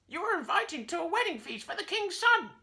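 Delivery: very high squawky parrot echo; parrot repeating. A narrator's reading. Parrot repeats Curly's invitation, very high and squawky. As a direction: echo every word like a parrot. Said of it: "the parrot repeated".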